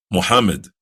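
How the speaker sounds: ordinary talk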